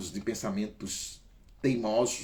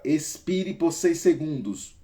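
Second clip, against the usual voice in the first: higher, louder exhale instruction; breathing coach; 4 semitones higher, 5 dB louder than usual. Shown as a demonstration. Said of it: "guiding a breathing exercise".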